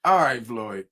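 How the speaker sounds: ordinary delivery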